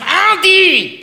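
high, loud call of a name; mock shout at Adi.

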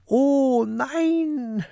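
high, drawn-out cry of dismay; long, high dismayed yell; sudden cry. A narrator's reading. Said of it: "schreit Peter plötzlich".

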